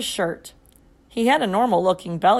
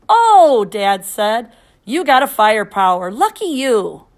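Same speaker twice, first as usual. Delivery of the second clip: loud, delighted exclamation; excited; 7 dB louder than usual. Acted. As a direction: cheer at the good news. Dad excited about firepower.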